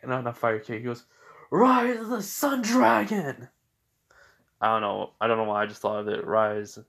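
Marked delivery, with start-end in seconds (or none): 1.5-3.5 high, slow, drawn-out attack cry; attack name shout. Acted. Naruto kicking the fire dragon, slow high attack cry. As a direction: call the move like an anime hero.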